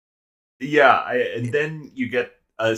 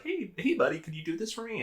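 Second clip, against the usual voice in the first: high, soft, sugary mock voice of a smarmy classmate; a user softly sweet-talking his buddy into doing his work; fake-friendly ask; 9 semitones higher, 10 dB quieter than usual. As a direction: coax sweetly, faking friendship. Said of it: "He's just like".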